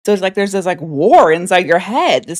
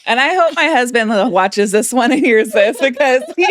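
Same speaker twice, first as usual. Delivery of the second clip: higher, giggly telling; real laughing through words; 6 semitones higher than usual. Real, not acted. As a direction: talk through helpless laughter. Laughing at shared experience.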